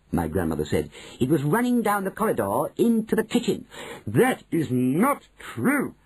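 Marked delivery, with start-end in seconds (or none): 1.2-3.7 pitched higher, insisting; grandmother insisting. A narrator's reading. Grandmother to manager, a higher insistent voice. 4.1-5.9 slow, higher, affronted denial; affronted manager. A narrator's reading. Manager to grandmother, slow offended denial. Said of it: "cried Mister Stringer".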